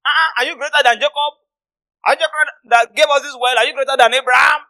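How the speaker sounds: high, loud questioning with rising ends; indignant challenge. Acted. Elders challenging young Jesus, indignant. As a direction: throw the questions out in outrage.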